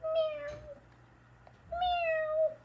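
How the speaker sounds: very high kitten meows; high squeaky mock meowing; cat meows. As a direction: meow like a tiny cat. Imitating a cat.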